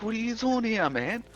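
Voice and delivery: high surprised greeting as Anthony; young coworker. Retold or imitated.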